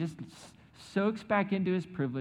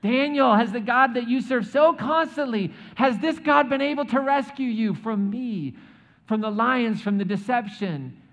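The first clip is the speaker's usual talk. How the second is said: high, loud, hammed-up taunting call; mock taunting king; 9 semitones higher, 9 dB louder than usual. Preacher plays the king mockingly calling down to Daniel, loud and hammed-up. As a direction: belt it out like a smug king teasing from above. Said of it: "I'd make him sweat it out".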